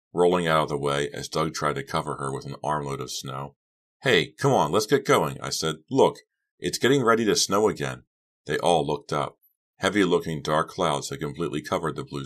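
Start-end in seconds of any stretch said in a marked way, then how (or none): 4.0-5.4 higher, louder, eager call; insistent rallying call; urging them on. A narrator's reading. Urging friends to leave. 5.9-8.0 raised, louder, urgent call; higher-pitched warning; alerting the others. A narrator's reading. Alerting friends to the sky.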